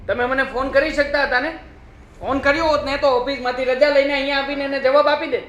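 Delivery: upset scolding. Acted. Son upset at wife's behaviour.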